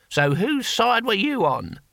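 slow, high questioning voice; drawn-out character question at moderate volume; exasperated comrade. A narrator's reading. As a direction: ask it pointedly, stretched out but not shouted. First asking of deaf comrade.

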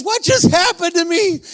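high, slow, stunned question; bewildered outcry. Retold or imitated. Healed man questioning the preacher, acted high bewilderment. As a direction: act out overwhelmed disbelief.

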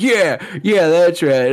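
high, loud, cocky yeahs; hammed-up tough talk; mock bravado. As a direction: gloat like an action hero. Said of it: "they're like".

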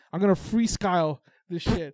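loud, slow declaration; retold loud announcement. Retelling a rapper's boast to a friend, loud announcement. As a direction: announce it big, stretching the words.

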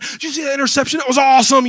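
loud, high sports-fan cheer; excited shout. Retold or imitated. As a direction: yell it like a thrilled fan.

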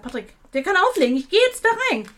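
high, loud, fed-up declaration; retold angry outburst. Customer tells partner on the phone she's going in, irate. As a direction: re-enact storming in, rising to high pitch.

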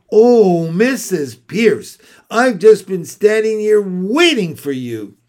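bright and loud, meant for ears behind the door; loud warning greeting. Acted. Girl greets teacher loudly to warn hiding friend, loud. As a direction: call it out so the hidden friend hears.

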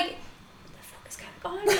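slow, whispered disbelief; stunned whisper. Retold or imitated. Retelling being shouted at by a lad, whispered bewilderment. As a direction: whisper it, drawing out your bewilderment. Bewildered aside during an argument.

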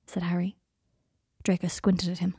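ordinary delivery

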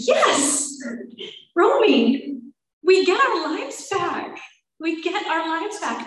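high, drawn-out, exasperated-sounding insistence; emphatic reassurance. Retold or imitated. Counselor telling patient they get their lives back, emphatic. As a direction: spell it out emphatically, pitch swooping up.

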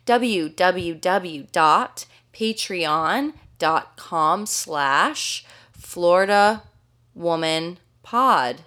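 slowly spelled out, word by word; slow dictation. Shown as a demonstration. Spelling out the web address.

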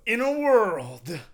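loud, slow trailer announcer; big trailer voice. Pretend. Host restarting the mock trailer, big trailer voice. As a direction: boom it out, drawn long. Trailer line again, projected.

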